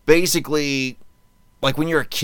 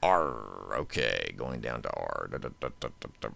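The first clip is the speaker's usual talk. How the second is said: low, much softer muttered search; mumbling to self; 13 semitones lower, 10 dB quieter than usual. Real, not acted. Host searching his list, muttering to himself. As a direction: hum under your breath while looking.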